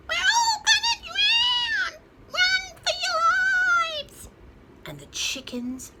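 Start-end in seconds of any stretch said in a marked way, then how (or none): 0.1-4.2 very high, slow, loud chick squeal; panicked peep. A narrator's reading. Smallest chicken panics at the rain, shrieking peep.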